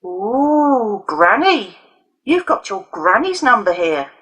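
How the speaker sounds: high, loud mocking Ooh Granny; crowing taunt. A narrator's reading. Leo crowing over Alison's phone, mocking taunt. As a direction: jeer as if you found a prize. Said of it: "Leo was crowing".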